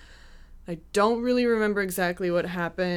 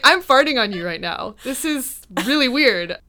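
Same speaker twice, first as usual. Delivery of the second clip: amused, higher and louder confession; retold helpless laughing; 5 semitones higher, 7 dB louder than usual. Injured woman confessing to her date, helplessly amused. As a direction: act out giddy, helpless embarrassment. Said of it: "nothing else for me to do but keep laughing".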